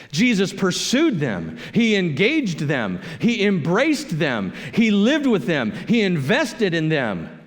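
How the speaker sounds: preaching. Real, not acted. Pastor drives the point to the congregation, slow and preaching. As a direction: hammer each line home slowly. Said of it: "preaching build, repeated clauses".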